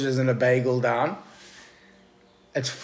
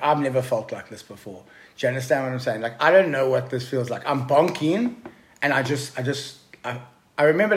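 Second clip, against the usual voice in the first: ordinary delivery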